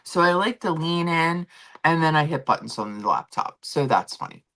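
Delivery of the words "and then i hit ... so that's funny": ordinary talk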